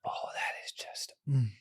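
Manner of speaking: hushed, breathless whisper after a sip; savouring whisper. Real, not acted. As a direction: whisper it as if the taste stole your breath. Savouring first sip of scotch.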